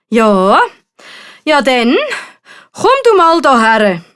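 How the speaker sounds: loud, commanding dragon. A narrator's reading. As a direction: summon the knight with dragon's authority. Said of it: "fordert der Drache den Ritter auf". (fordernde Drachenstimme)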